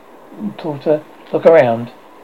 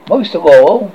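loud, higher, impassioned pirate; fervent outburst; passionately; 4 semitones higher, 9 dB louder than usual. Acted. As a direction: burst out with passion. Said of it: "Hook said passionately".

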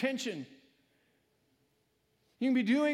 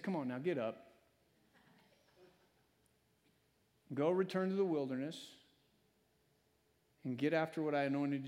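gentle God voice; 5 semitones lower, 5 dB quieter than usual. Pretend. God gently commissions Elijah.

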